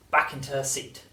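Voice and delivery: ordinary delivery, nothing marked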